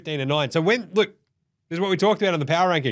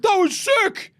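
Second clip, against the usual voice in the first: very high, loud stunned exclamation; hammed-up shock; amazed yell; 4 dB louder, slower than usual. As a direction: shriek in disbelief. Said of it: "I'm like".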